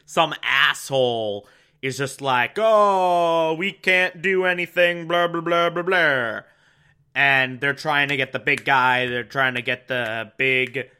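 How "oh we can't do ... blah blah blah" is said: jeering blah-blah imitation; mocking whine. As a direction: mimic a useless provider dismissively. Mocks provider's whining excuse.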